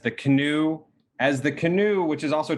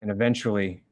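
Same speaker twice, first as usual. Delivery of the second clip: hushed, lower narration with long pauses; grave, measured game master narration; solemn scene setting; 3 semitones lower than usual. A narrator's reading. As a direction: let each phrase settle before the next.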